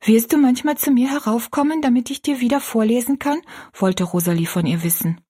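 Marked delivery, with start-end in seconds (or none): 0.0-3.4 a hint of an earnest child, a touch higher; sweetly sure of it; lightly voiced little girl. Rosalie assures the maid, earnest child voice.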